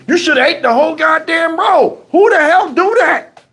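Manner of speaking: high-pitched comic outrage; hot-tempered. Retold or imitated. Man telling her off over the bitten roll, hot-tempered. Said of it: "Yo, I was hot".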